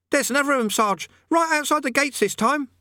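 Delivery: high, calling out urgently. A narrator's reading. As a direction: burst in with the news. Soldier reports urgently.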